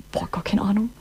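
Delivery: unvoiced whisper of confusion; whispered aside; whispered thought. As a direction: breathe it out like a puzzled listener. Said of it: "denkt so".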